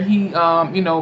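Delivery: ordinary delivery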